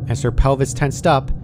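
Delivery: plain reading voice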